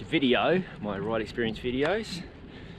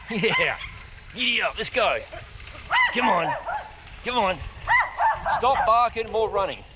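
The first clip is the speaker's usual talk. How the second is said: higher, louder cheering a dog on; excited urging; 10 semitones higher, 4 dB louder than usual. Real, not acted. Cyclist urges the dog chasing him to keep running, excited and loud.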